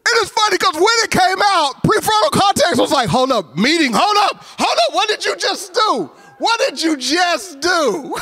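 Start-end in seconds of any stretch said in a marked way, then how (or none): 3.1-8.1 alarmed inner voice. Retold or imitated. Prefrontal cortex voiced alarmed.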